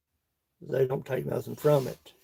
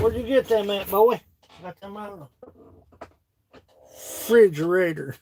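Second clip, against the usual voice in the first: mock Kentucky accent; 5 semitones higher than usual. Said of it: "makes fun of you saying refrigerator".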